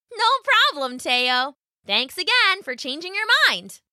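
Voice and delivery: high, cheerful rabbit voice; friendly rabbit. A narrator's reading. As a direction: thank him warmly. Rabbit speaks.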